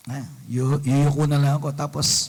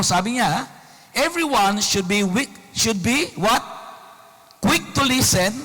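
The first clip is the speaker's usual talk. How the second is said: scripture reading; 4 semitones higher than usual. Acted. Reads Bible verse aloud to congregation.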